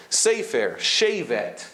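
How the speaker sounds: "Sefer", "shevet" with a long ay; long A tserei. Shown as a demonstration. Teacher giving examples of tserei, long A sound. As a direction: say SAY-fer, SHAY-vet. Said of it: "which is the long A sound".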